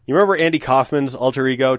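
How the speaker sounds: usual speaking voice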